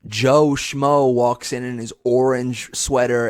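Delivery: normal talking voice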